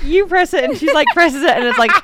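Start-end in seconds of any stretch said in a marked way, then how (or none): 0.0-0.6 high, eager "you press it"; playful urging. Retold or imitated. Telling her wife to press it, high and eager. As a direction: nudge her to click the button.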